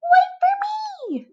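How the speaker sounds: a bug who can't believe he gets wings; squeaky gasp of wonder; amazed caterpillar. Acted.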